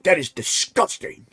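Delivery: a hammed-up British accent, wagging a finger at the viewer; mock British accent. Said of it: "I don't know why I'm doing that fucking voice".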